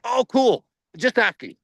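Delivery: lightly acted awkward backpedal; mock-casual backing off. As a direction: back off quickly, feigning nonchalance. Acts out backing off after awkward question.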